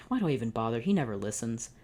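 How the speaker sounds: ordinary delivery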